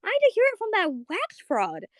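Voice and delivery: high-pitched mock outrage; Marceline, pissed off. Confronting Bubblegum about being throne jacked, mock-pissed off. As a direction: imitate an indignant rant way up high. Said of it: "she's, like, really pissed off".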